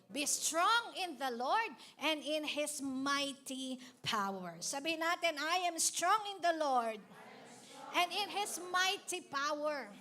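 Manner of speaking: loud, high, drawn-out preaching; fiery pulpit preaching; preaching at full force. Acted. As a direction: proclaim the verse to the congregation. Leads congregation in declaration.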